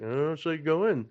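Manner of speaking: mock hesitation, asking timidly; hesitant fans. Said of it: "everybody was so hesitant".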